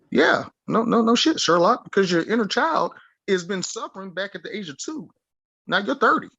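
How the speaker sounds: high mocking no shit Sherlock; sarcastic. Real, not acted. Sarcastic retort to the listener.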